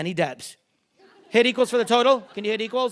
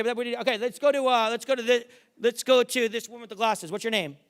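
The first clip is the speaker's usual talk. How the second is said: ordinary delivery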